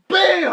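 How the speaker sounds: very high, loud "bam". Retold or imitated. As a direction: slam the impact word out. Imitates impact.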